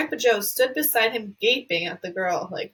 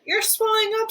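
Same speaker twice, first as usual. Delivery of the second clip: much higher panicked scream; 12 semitones higher, 3 dB louder than usual. Acted. A mother screaming at her swelling daughter, much higher. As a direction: shriek it in alarm. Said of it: "screamed Missus Beauregard again".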